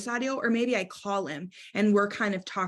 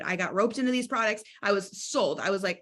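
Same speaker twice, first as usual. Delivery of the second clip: usual speaking voice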